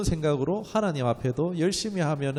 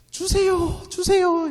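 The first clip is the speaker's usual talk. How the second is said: very high, louder mock whine; whiny begging; 7 dB louder, slower than usual. Pastor mocks believers whining at God, very high. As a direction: plead like a crying child. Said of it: "막 울고 짜고 굴고 해야지".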